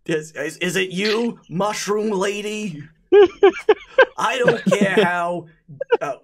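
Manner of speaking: high, loud tough-guy boast; spaghetti golem voice. Acted. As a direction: swagger like a pasta gangster.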